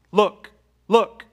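high, loud urgent call; urgent elder. Acted. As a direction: call out to make him look. Elder urging John to look.

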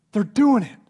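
loud, high, exultant proclamation; emphatic excitement. Acted. Timothy insists to Paul that they're thriving, exultant. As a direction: punch it out high and loud in triumph. Timothy acted, peak of good news.

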